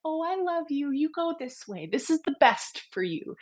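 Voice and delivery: a hint of a doting nana reassuring you; loving grandma. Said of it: "the voice of somebody who's kind and loving".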